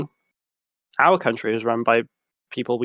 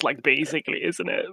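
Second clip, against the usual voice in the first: usual speaking voice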